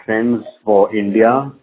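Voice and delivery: usual speaking voice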